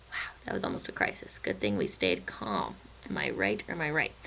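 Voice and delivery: very low, soft, near-voiceless murmur; deadpan calm after a small panic; hushed mock relief. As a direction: drop right down to a low hush. Mock relief after on-screen mishap.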